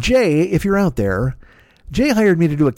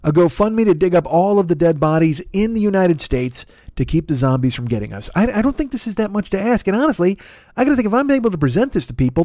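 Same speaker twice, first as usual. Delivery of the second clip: usual speaking voice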